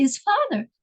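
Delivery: ordinary talk, nothing marked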